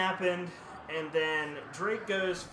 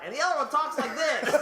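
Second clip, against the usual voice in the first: much higher, laughing; high squeaky voice; 9 semitones higher, 3 dB louder than usual. Shown as a demonstration. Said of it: "one of them talks like that".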